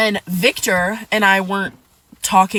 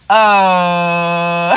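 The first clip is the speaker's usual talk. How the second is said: long, loud, droning "uh"; drawn-out dumbfounded stall; stunned hesitation; 4 semitones lower, 5 dB louder than usual. Retold or imitated. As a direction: hold one low note in disbelief. Acting stunned reply to Bob.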